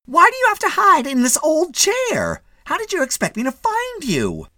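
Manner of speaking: very high, exasperated nagging; scolding wife. A narrator's reading. Wife to husband hidden in chair, scolding. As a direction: nag him in a piercingly high voice.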